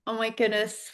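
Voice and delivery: usual speaking voice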